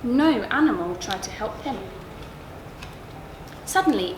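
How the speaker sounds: plain reading voice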